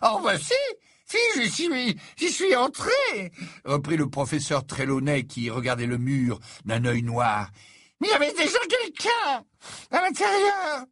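a dithering prophetess confessing reluctantly; flustered seer. Acted. Trelawney recounts the room.

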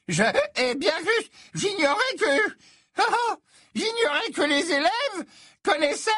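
put-on very high, stammering old seer; an eccentric teacher caught out and dodging; flustered seer.

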